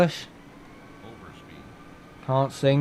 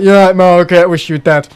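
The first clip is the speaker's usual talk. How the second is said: loud, higher blunt yell; mock blunt shout; 5 semitones higher, 10 dB louder than usual. Streamer showing his own rude version, shouting. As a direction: bark it out rudely as a joke. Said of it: "Mine's like".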